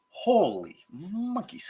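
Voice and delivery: very slow, drawn-out amazement; overwhelmed exclamation. Real, not acted. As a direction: stretch each word, reeling from the burn. Reeling from hot sauce burn.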